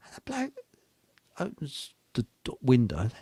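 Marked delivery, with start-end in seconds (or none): none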